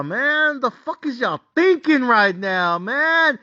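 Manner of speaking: drawn-out groan into worked-up scolding; exasperated scolding. Retold or imitated. Bettor berating his team over a field goal, exasperated. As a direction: whine and berate, voice climbing on each "man".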